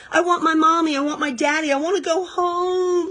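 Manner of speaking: high, wailing plea for mommy and daddy; a little girl lost underground, wailing for her parents; wailing child. A narrator's reading. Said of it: "she wailed".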